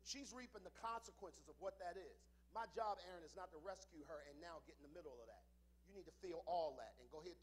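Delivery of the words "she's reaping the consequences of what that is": ordinary talk, nothing marked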